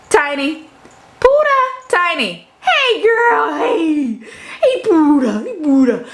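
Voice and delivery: squeaky laughing cat greeting; laughing pet talk. Real, not acted. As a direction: coo at the cats through laughter.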